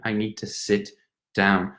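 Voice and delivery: ordinary talk, nothing marked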